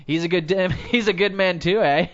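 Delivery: hammed-up rural Vermont twang; loud half-laughing Vermont yokel quote; Vermont accent. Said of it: "some type of Vermont accent".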